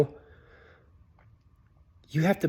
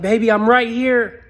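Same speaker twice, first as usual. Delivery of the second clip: urgent call; 7 semitones higher, 8 dB louder than usual. Retold or imitated. Ghost calling to grieving wife.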